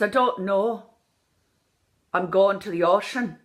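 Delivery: Irish pure O in "don't know" and "ocean"; Irish accent. Shown as a demonstration. Coach showing the correct take, Irish accent. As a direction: keep a still-lipped Irish O. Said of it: "So I look like a ventriloquist".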